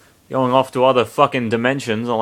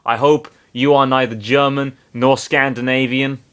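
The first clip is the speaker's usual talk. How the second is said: plain reading voice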